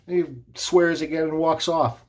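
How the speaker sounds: plain reading voice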